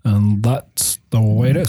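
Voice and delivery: low, slow newsman sign-off; a hint of Walter Cronkite, low and measured. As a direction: close the broadcast like a veteran anchorman. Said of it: "You just dropped the cronkite line".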